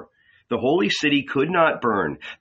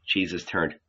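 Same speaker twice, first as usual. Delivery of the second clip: ordinary delivery, nothing marked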